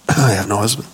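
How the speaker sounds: a woman owning her painful past, hushed; ashamed, sad. Shown as a demonstration. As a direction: let "I have no husband." sink low with shame. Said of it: "Think she said it like that?".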